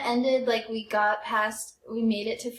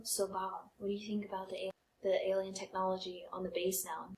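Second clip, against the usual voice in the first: sly question; 4 semitones lower, 10 dB quieter than usual. Retold or imitated. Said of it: "I asked him".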